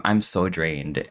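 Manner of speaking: usual speaking voice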